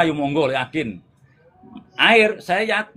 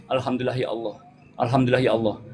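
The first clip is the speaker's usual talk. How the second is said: low, steady murmured litany; inward dhikr; 6 semitones lower, 4 dB quieter, faster than usual. Shown as a demonstration. Teacher shows dhikr kept going in the heart, low recitation. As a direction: repeat it low, as if only in the heart.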